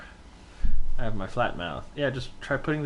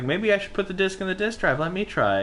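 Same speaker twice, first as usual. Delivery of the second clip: imitating Leon; 10 semitones higher than usual. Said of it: "It's like".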